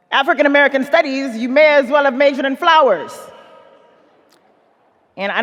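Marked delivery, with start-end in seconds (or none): 0.1-3.2 loud fast West African dad yell. Retold or imitated. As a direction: shout it fast and higher. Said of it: "he yelled".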